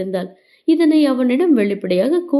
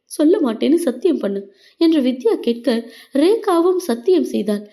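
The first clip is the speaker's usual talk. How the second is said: plain reading voice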